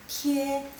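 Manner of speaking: "tie" held on a level pitch; sustained, level "tie"; first tone. Shown as a demonstration. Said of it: "nada satu".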